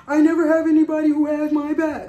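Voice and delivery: high, loud whiny mimicry of the friend; mocking the friend. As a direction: parody her playing the victim. Mocking friend's complaint to others.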